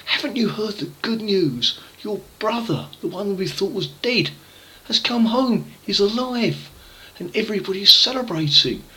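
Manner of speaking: high eager servant voice; excited servant. Acted. Servant tells the elder brother the good news, excited and high. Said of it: "one of the servants said".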